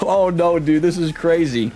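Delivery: usual speaking voice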